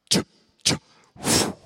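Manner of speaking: breathy whooshing dart sounds. Retold or imitated. Imitating darts flying past the tree, whooshing. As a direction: mimic darts zipping past.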